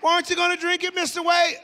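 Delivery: high, loud pushy host voice; imitating the host. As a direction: play the host nudging him to drink. Retold host urging him to drink.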